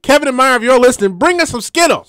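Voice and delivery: urgent high-pitched shout-out; calling out. Real, not acted. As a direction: call out to absent colleagues. Calls out to absent colleagues.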